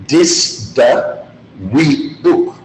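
"Dis da we book" slowly, each word separate; slow teaching demo of "Dis da we book". Shown as a demonstration. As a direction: space out "Dis da we book" for learners. Teacher breaks phrase down word by word.

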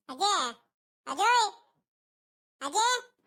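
rising calls for grandma; calling out. Acted. Boy summons his grandma for the visitor, calling out.

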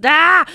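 a short mock demon cry, high and loud; demon voice yell. Coach imitating the singer's inner demon voice, mock yell. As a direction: let the inner demon burst out for a moment. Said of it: "inner demon voice that just wants to come out".

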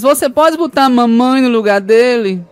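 a wife sweetly asking a favour for her mother. Retold or imitated. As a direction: ask it like a sugary scheming wife. Wife voiced in joke.

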